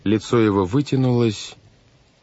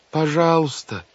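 high-pitched desperate plea; crying out; 9 semitones higher than usual. A narrator's reading. Girl makes a last appeal, crying out. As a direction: cry out begging, pitched up. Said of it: "закричала Вайолет".

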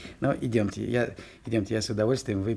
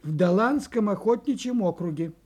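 mock-grand signature; 7 semitones higher, 3 dB louder than usual. Butcher reading the fox's signature to forester, mock-grand.

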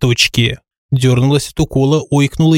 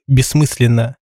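ordinary delivery, nothing marked

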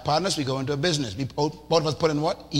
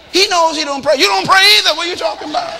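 loud, high, fiery comeback; shouted retort; 12 semitones higher, 11 dB louder, faster than usual. Real, not acted. Preacher shouts back at wives in the congregation, fiery. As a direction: hit back hard at the nagging. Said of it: "preacher shouting retort".

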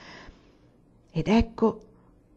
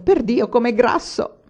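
gleeful, raised, punchy exclamation; delighted; 5 semitones higher, 4 dB louder than usual. A narrator's reading. Mastro Pane to his mate over the caught cat, delighted. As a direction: exclaim it with pleased relish. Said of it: "disse tutto contento".

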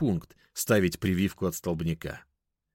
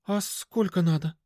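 put-on high-pitched, uncertain girl; confused high question; bewildered; 10 semitones higher than usual. A narrator's reading. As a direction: ask at a loss, voice pitched up. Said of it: "растерянно спросила Даша".

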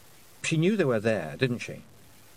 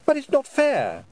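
high, louder protest; indignant boy; 6 dB louder than usual. A narrator's reading. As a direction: object hotly as a young lad.

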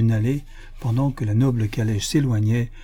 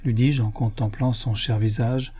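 ordinary delivery

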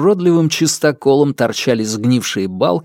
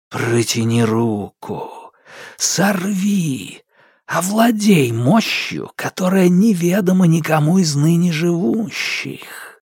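ominous darkness voice; 5 semitones higher than usual. Acted. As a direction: intone it like a dark spirit. Darkness tempts him.

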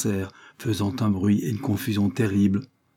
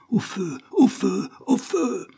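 rising higher with each cry of fire; terrified cries; 12 semitones higher, slower than usual. A narrator's reading. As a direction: panic climbing with every call. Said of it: "avec l'accent de la terreur".

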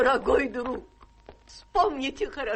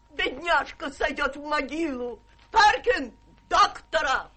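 normal talking voice

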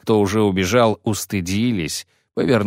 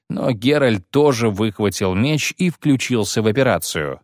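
usual speaking voice

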